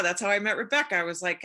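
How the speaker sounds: ordinary talk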